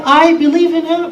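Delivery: high, loud ringing declaration; proclaimed conviction. Retold or imitated. Preacher voicing Abu Bakr's faith, high and loud. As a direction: proclaim it like a vow.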